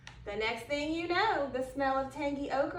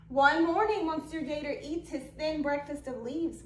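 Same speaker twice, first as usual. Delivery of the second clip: ordinary delivery, nothing marked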